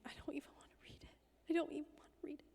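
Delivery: hushed, breathy repeat of a weary father; barely voiced, as if too tired to look; retold whisper. Dad refusing to read the email, in a hushed whisper.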